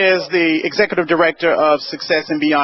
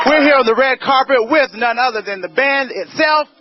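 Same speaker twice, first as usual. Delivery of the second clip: bubbly red carpet host; gushing red carpet chatter; 5 semitones higher than usual. Acted.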